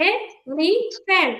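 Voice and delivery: Elephant drawn out e-li-fant; teacher slowly splits elephant; very slow syllable demo. Shown as a demonstration. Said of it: "তিনটা vowel sound আছে".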